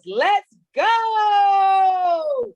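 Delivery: loud, high hype call; hype shout. Real, not acted. Host hyping the crowd for the guest: loud excited shout. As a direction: rev up the crowd as she joins.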